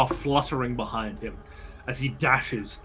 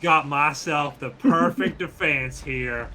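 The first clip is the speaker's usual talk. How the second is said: southern drawl; 3 semitones higher, 7 dB louder than usual. Acted. Rabbit-hunting bandit boasts of his defense, southern drawl. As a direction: lay on a thick Southern drawl. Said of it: "It's like a southern drawl".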